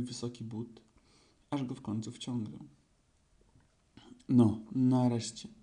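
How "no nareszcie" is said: loud, slow, puffed-out relief. A narrator's reading. Pooh after pushing the boot on, puffed and relieved. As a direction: let it out heavily after the effort. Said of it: "odsapnął Puchatek".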